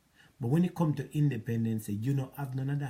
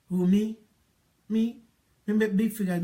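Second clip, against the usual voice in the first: higher and louder, a mocking play of her outraged denial; mock indignation; 7 semitones higher, 5 dB louder than usual. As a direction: parrot her protest like a caricature.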